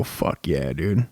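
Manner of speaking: mock a dumb bro hyped about her. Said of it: "watch Kathy Bates and go".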